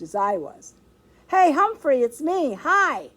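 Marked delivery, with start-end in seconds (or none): 1.3-3.1 put-on high, loud hello from a child; shouted greeting. A narrator's reading. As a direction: call out eagerly to a friend. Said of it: "a familiar voice shouted".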